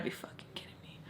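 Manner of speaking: fast, whispered under the breath; whispered curse. Retold or imitated. Teacher, thwarted by Joe, whispers a quick curse. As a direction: hiss it out in disbelief. Teacher muttering after being refused.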